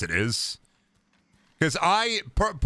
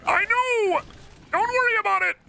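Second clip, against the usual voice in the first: high falsetto YouTuber yelps; squeaky parody of a hurt YouTuber; high-pitched mock pain; Markiplier impression. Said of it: "Markiplier, but he was hit".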